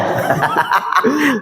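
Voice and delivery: short burst of real chuckling; sheepish laugh. Real, not acted. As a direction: laugh at your own slip. Realises the mic was forgotten.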